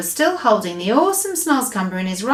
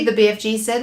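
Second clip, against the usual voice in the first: plain reading voice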